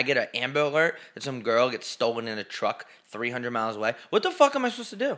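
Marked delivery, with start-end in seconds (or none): 4.1-5.2 exasperated. Real, not acted. Exasperated rant.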